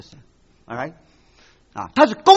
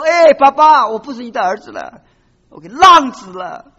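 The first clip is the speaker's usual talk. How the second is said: pleading son; 9 dB louder than usual. Acted. Prodigal son pleads with father.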